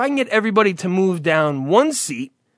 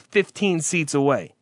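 normal talking voice

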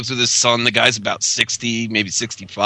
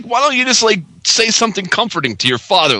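mock reproach; 8 semitones higher than usual. Imagined scolding of the son.

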